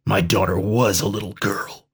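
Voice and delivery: a touch of a growl, held in; mildly growled retort; understated growl. A narrator's reading. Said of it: "growled Dax".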